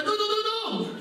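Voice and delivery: high insistent nagging; impatient urging. Retold or imitated. Coordinator pushing the student to talk, high and nagging. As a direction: hurry her on with rising impatience. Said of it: "עושה לה".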